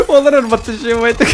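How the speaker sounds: high laugh into the words. Real, not acted. Laughs at opponent's move.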